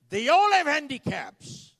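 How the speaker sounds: high, loud outburst as the boss; retold emphatic shout. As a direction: shout it out to drive the point home. Acted manager scolding the salesman.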